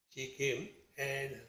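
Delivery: ordinary talk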